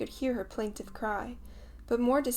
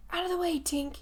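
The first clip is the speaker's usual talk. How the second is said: high, raised shout; 9 semitones higher than usual. A narrator's reading. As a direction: clear the fairy from the line of fire. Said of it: "he shouted".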